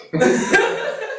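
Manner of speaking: bright high laugh. Real, not acted. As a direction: laugh out at the joke. Laughs at own joke.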